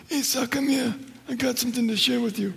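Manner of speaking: high, hushed mobster rasp; a hammed-up Godfather voice; Godfather impression. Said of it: "Probably like The Godfather".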